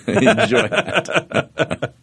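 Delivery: breaks into a high laugh on the words. Real, not acted. Recalling the stage throat-cutting, laughing. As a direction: laugh it out, tickled by the memory. Laughing at his own joke.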